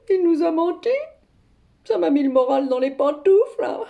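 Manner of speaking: ordinary talk, nothing marked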